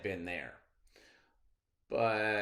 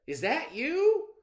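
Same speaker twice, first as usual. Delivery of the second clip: high, loud, slow hopeful call; eager rabbit call; 9 dB louder than usual. Acted. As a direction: sound out who's there. Rabbit calls from box.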